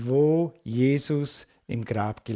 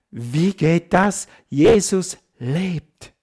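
joyful; 4 semitones higher, 4 dB louder, much slower than usual. Real, not acted. Joy at resurrection.

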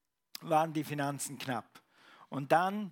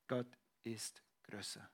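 "Gott ist größer" whispered, much softer and lower; 5 semitones lower, 12 dB quieter than usual. Shown as a demonstration. Preacher showing what he says when words fail, whispering "Gott ist größer". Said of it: "dann sage ich immer".

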